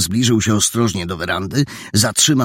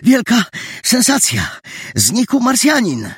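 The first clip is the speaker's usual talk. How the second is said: high-pitched, urgent big news; excited high-voiced boy; breathless excitement. A narrator's reading. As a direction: blurt out sensational news, short of breath. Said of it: "nie mógł złapać oddechu".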